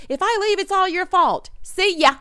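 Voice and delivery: high, bratty exit line; mock storming off. Imitating a housemate storming off, high pitched. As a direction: flounce out high and sassy. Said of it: "storms off saying that".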